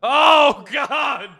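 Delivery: loud, drawn-out cry of dismay; hammed-up loud exclamation; dismayed shout. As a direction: wail it out loudly. Dismay at talk of striking.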